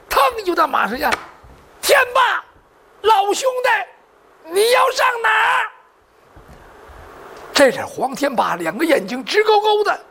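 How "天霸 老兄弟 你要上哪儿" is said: high, drawn-out call to stop a friend; calling after someone. Acted. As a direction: shout after him, stretching the last word. Rider calls after his departing friend. (高声拉长音追着喊人)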